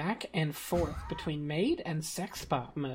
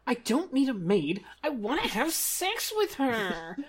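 frustrated whiny customer; 9 semitones higher, 3 dB louder than usual. Acted. Monica voiced, frustrated at bot.

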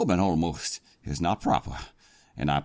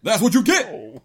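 higher, louder gloating yell; 13 semitones higher, 6 dB louder than usual. Acted. The president gloating at the captain mid-fight, yelling. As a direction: crow over the hit.

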